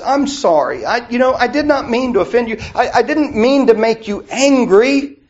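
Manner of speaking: high, lightly pleading apology; apologetic brother. Retold or imitated. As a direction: plead sorry like a sheepish brother. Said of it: "our brother says".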